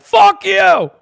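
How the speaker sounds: sudden high shout of a nihilistic douchebag; mock cynic shout. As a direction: bark it back like a furious cynic. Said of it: "nihilistic douchebag being like".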